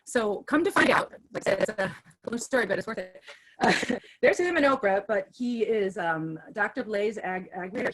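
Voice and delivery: usual speaking voice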